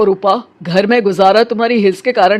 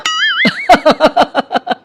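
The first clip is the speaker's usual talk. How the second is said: light laugh; 7 semitones higher than usual. Acted. Laughs at her own worry.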